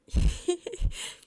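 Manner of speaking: high, loud giggle. A narrator's reading. Tipsy guest scoots closer to Kyle, giggling. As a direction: burst into a short squeaky giggle.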